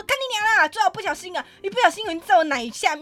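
loud, high furious swearing; furious yelling. Retold or imitated. To the groper, furious swearing. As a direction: curse him out at full volume.

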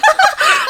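high, loud burst of laughter. Real, not acted. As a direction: crack up at the news. 确诊后忍不住大笑. (高声爆笑)

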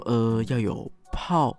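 slow, unsure, halting reply; stall, then offer a lame guess; hesitant answer. Retold or imitated. Caught off guard by the master, answering slowly and unsure. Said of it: "我没有料到他会问我这种问题".

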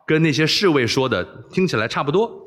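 plain reading voice

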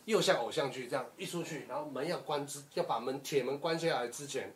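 usual speaking voice

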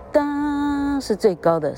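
哒 sung high and slow to show the stressed beat. Shown as a demonstration. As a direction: lift 哒 way up, then slow down.

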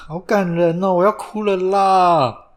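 high, drawn-out touched whine; mock tearful. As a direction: play at being moved to tears.

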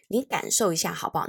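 ordinary talk, nothing marked

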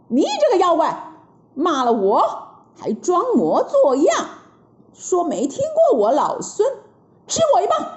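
high furious battle cry; angry Monkey King. Acted. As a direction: roar it as you strike. Monkey attacks the demon. (孙悟空怒喝出棒)

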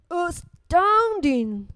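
slow, drawn-out monster amazement; astounded Gruffalo. Acted. Gruffalo sees Owl flee the mouse, astounded. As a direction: stretch the word out like an astonished beast.

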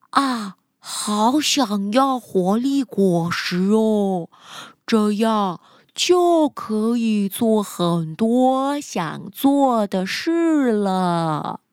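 slow, dreamy sloth voice. Acted. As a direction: drag each word out lazily. Sloth thinks to itself.

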